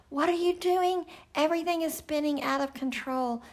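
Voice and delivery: mock alarm, everything spinning; panicked question. Shown as a demonstration. Preacher acts out a panicked prayer, frantic. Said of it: "instead of".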